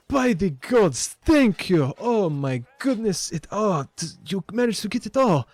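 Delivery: put-on high, loud accented gushing; excited shopkeeper. Shopkeeper sees her recovered crates, high excited accented thanks. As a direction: gush with overjoyed relief in the shopkeeper's accent. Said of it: "very excited, very excited".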